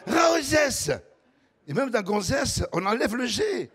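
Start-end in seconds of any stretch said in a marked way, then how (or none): 0.1-0.9 Ra shouted, high and loud; Toulouse Ra. Shown as a demonstration. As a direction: call out Ra as if pointing far away. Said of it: "Ça veut dire «regarde»". (Ra crié comme pour montrer au loin)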